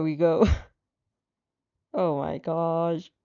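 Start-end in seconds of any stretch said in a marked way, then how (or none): none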